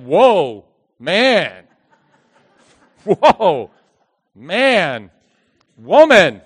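loud, drawn-out awestruck whoa, man; Adam stunned by the first woman; loud wondering gasp of delight; amazed whoa. Acted.

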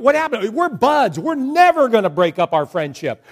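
mild pleading, don't leave us; lightly voiced hurt friends. Bambi's friend protests being left behind, mildly hurt.

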